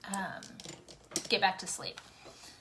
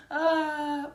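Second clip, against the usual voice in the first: laughing; 7 semitones higher than usual. Real, not acted. Laughing after joke.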